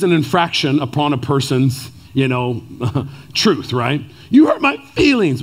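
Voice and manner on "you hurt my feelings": put-on toddler voice. Imitating two-year-old daughter whining at her parents. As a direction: whine it like a hurt toddler. Repeating daughter's whiny line.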